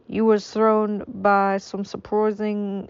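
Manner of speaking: slow, drawn-out mock French accent. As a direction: stretch the words in a clumsy French accent. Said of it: "I don't know what this accent is".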